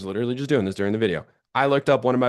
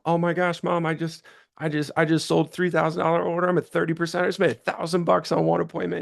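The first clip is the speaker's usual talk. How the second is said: higher, breathless rush of news; excited retelling; 6 semitones higher than usual. Retold or imitated. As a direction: gush the big win to mom. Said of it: "Call my mom".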